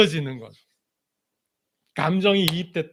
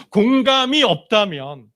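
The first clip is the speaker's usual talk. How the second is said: usual speaking voice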